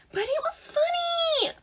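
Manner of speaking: put-on curious high girlish voice; Himiko's voice. A narrator's reading. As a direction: pitch way up for the playful girl.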